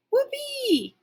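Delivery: very high, squealing joy; thrilled dog cheering; whoopee cheer. A narrator's reading. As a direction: shriek it with puppy glee.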